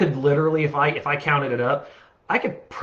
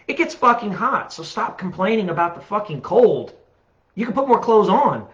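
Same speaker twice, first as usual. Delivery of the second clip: exasperated rant; 7 semitones higher than usual. Real, not acted. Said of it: "that's a good little rant".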